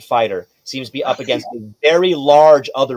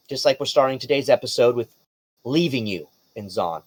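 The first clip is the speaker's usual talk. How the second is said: usual speaking voice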